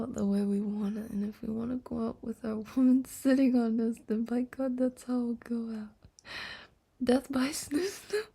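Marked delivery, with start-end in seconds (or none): none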